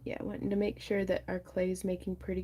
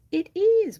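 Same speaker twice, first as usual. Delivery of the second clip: high, loud delighted exclamation; excited; 12 semitones higher, 13 dB louder than usual. Real, not acted. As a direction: cheer that it fits. Delighted find.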